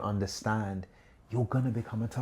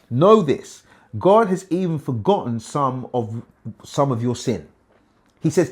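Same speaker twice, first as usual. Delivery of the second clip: loud, raised preaching of the passage; fiery sermon reading; preaching scripture; 3 semitones higher, 9 dB louder than usual. Acted. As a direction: declaim it to the congregation. Preaching scripture at full force.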